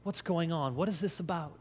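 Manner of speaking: softly bewildered questions; hushed acted puzzlement; puzzled aside. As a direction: ask one another quietly, confused. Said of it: "imagine them turning to one another".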